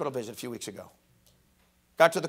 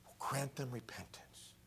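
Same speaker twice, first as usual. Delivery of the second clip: whispering; 7 semitones lower, 21 dB quieter than usual. Acted. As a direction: whisper the verse reverently. Scripture whispered.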